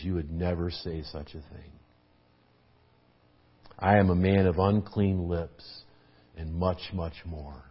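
a low stern reproach that sinks almost to nothing; stern rebuke. Retold or imitated. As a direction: scold her gravely, then let it drop away. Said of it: "said sternly".

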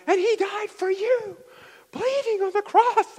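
high, drawn-out preaching shout; super passionate. Retold or imitated. Preacher re-enacting his conference sermon, super-passionate shout. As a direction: cry it out with full fervor. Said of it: "like, super passionate".

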